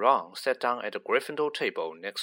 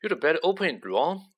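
put-on timid boy, slightly pitched up; mildly anxious, higher character voice, not truly whispered; 5 semitones higher, faster than usual. A narrator's reading. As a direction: urge a friend nervously. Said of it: "in a timid whisper".

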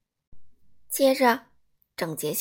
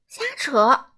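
louder, fussy outrage; indignant exclaim; 4 semitones higher, 7 dB louder than usual. A narrator's reading. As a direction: dismiss it with a huff. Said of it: "整洁先生说". (大声、不满地说)

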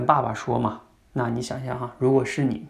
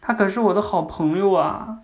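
acted young daughter, pitched up; little daughter; 7 semitones higher, 4 dB louder than usual. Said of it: "女儿会说什么".

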